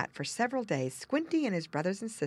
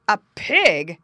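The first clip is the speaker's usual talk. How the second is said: loud, higher-pitched disbelief; astonished cry; 4 semitones higher, 10 dB louder than usual. A narrator's reading. Father hearing boy's request, astonished. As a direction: exclaim it in surprise. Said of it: "cried Father".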